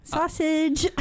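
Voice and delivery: high mock accent from The Descent; film-quote accent. Cohost quoting The Descent for a smile, in a mock accent. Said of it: "With the accent".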